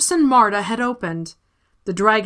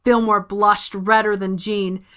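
plain reading voice

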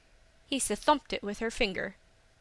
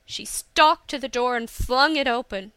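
ordinary delivery, nothing marked

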